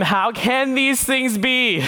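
high, slow, bewildered question; baffled Nicodemus. Acted. Nicodemus to Jesus, high slow baffled question. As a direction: Nicodemus scratching his head, utterly lost. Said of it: "Nicodemus says to him".

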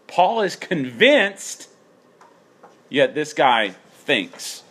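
slow it down and lean on "convinced" then "thinks"; contrast emphasis. Shown as a demonstration. Preacher contrasting Paul and weaker brother, slow emphasis. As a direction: stress "convinced" against "thinks", slowly. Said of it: "notice the difference between Paul and this guy".

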